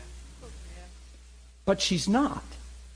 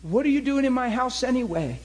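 retold yelling; 9 semitones higher than usual. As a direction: shout them out of the house, much higher. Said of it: "she'd yell at him".

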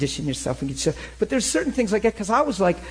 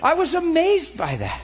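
higher, amazed exclamation; 11 semitones higher than usual. Real, not acted. Preacher marvels to congregation at the turkey.

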